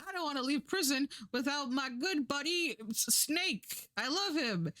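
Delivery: high, imploring character voice; pleading cartoon prisoner. Slaz refusing to leave his friend behind, mock pleading. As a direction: plead for a beloved buddy, pitched well up.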